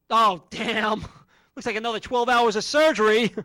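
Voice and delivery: loud pained groan of dismay; John Bobbitt impression. Bobbitt on Valentine's Day, loud pained groan. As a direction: shout it like a man wounded again. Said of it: "my impression of John Bobbitt".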